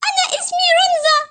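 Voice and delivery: high little character voice; timid tearful mermaid. Acted. Mermaid tells her new friends her name, tearful. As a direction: introduce yourself in a high, small mermaid voice.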